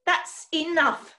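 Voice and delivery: loud, cross cut-off, leaning on the last word; fed-up rebuke; snapping. A narrator's reading. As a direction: snap at them to stop. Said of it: "snapped Hecky".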